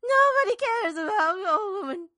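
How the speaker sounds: high, loud, hammed-up wail; mock wailing. Old woman to the council, hammed-up wailing. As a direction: howl it out like a self-pitying old lady.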